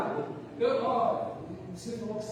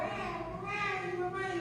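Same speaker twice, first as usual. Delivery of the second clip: put-on high, soft, drawn-out baby wail for mummy; baby daughter; 8 semitones higher, 5 dB quieter, slower than usual. Baby daughter calls for mummy when father steps away, high drawn-out imitation. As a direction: imitate a little girl calling, high and stretched. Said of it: "Você sai de perto da Rebeca, a Rebeca".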